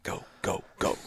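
unvoiced hushed go go go; whispered cheering. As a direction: cheer him on under your breath. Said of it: "And I'm like".

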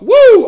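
very high, very loud whoop. Real, not acted. Signing off the video, loud whooping cheer. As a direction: let out a triumphant whoop.

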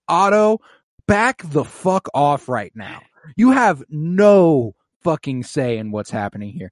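loud, punched re-enacted scolding; emphatic irritated telling-off; pissed-off rebuke. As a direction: lay down the law, fed up. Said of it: "pissed me the fuck off".

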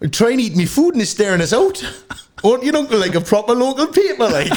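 put-on high-pitched Geordie accent; Newcastle accent. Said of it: "Newcastle, go".

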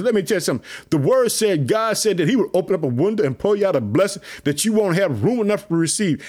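ordinary talk

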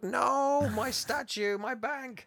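very high-pitched comic wail; hammed-up whimpering lament; mock crying. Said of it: "Mister Hodgkins is crying".

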